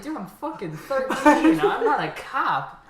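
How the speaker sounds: loud, high, drawn out through laughter; mock panicked protest. Thirteen-year-old protesting to the dealer in his head, mock panic.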